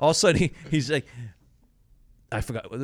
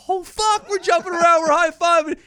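high, excited cry of relief; jubilant yell; 7 dB louder than usual. Retold or imitated. Teammate celebrating making the roster, high excited yell. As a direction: yell it out like you just made the team. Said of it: "And we're like".

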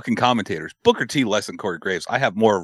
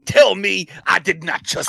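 Booker T impression; 10 semitones higher than usual. Imitating Booker T call.

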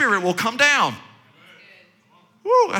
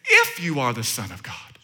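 much lower, softer taunting tempter; sly devil voice; 12 semitones lower, 5 dB quieter than usual. Acted. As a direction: tempt him in a low, sneering hush. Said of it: "the tempter came to him, he said".

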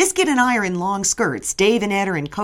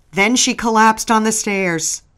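ordinary delivery, nothing marked

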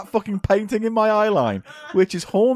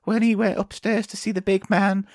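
put-on sad old lady; 3 semitones higher, slower than usual. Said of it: "make a sad, tragic story".

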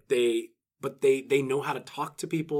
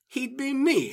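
mock boast; 10 semitones higher, slower than usual. Villain's boast, retold.

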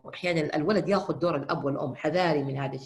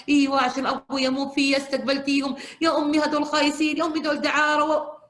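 higher, louder indignant outburst; angry sisters; 9 semitones higher, 6 dB louder than usual. Retold or imitated. Daughters scolding their mother, higher and louder. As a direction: protest to mother in disgust. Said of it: "الأخوات".

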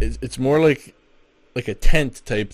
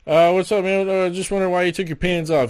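higher, louder interviewer voice; Joe Rogan voice; 8 semitones higher, 6 dB louder than usual. Retold or imitated. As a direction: ask it like a chummy interviewer. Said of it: "he's like".

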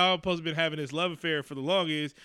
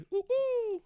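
high falsetto woo-hoo whoop; whooping cheer. Pretend. Celebrating stolen cash, a high gleeful whoop.

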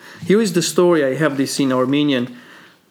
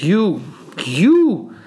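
drawn-out, rising mock accusation; acted accusation; 5 semitones higher than usual. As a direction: point the word like an accusing king. Shapur accuses captured Vasak.

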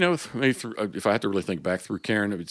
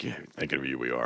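catchphrase voice; 10 semitones lower, 8 dB quieter than usual. Shown as a demonstration. Host asks his tagline, he answers in his deep catchphrase voice. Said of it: "sometimes I do do the voice".

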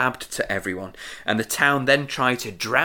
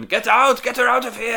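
raised retold shout, higher; 10 semitones higher, 4 dB louder than usual. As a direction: call out to throw her out. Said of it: "she's shouting".

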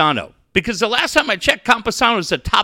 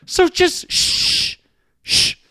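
a long, drawn-out unvoiced shh; shushing; 10 semitones higher, much slower than usual. Real, not acted. Dismissive shush at a radio host he can't stand. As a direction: hush him off the air.

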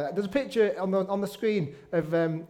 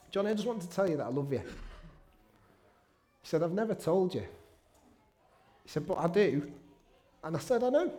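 real choked-up voice, soft and halting; getting emotional; 3 semitones lower, 4 dB quieter than usual. Real, not acted. Son retelling his father's first "I love you", getting emotional. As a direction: hold back tears while retelling it. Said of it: "I'm getting emotional now".

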